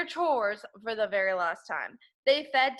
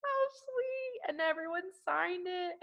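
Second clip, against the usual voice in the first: high, soft and touched; 9 semitones higher, 6 dB quieter than usual. Acted. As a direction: melt at the gift. Teacher reacts to present.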